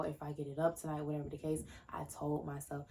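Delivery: ordinary talk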